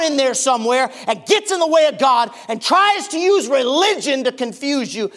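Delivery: loud, high, driving preacher's warning; preaching at full force. Acted. Pastor warns the congregation about the devil, preaching loud and high. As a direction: hammer it home like a revival preacher. Preaching hard against feelings.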